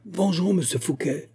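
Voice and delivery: a contained shout from the crowd; cri exalté. A narrator's reading. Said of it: "crièrent les plus exaltés". (cri exalté, voix haut perchée)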